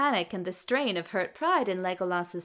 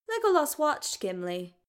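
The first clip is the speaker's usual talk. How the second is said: usual speaking voice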